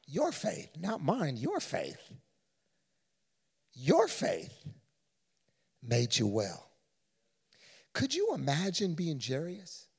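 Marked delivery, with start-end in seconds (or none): 0.0-6.7 soft, low, reassuring blessing; tender Jesus. Acted. Jesus blessing the healed woman, soft and tender. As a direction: speak peace over her, fading softly. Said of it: "And he says".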